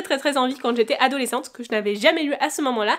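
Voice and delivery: ordinary talk, nothing marked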